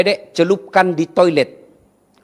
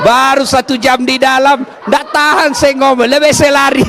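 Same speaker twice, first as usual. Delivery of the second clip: higher, louder laughing complaint; jinn laughing; 9 semitones higher, 4 dB louder than usual. Retold or imitated. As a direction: laugh through the jinn's escape. Jin mengeluh sambil tertawa. (jin mengeluh sambil tertawa, tinggi dan keras)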